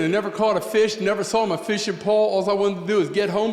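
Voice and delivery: usual speaking voice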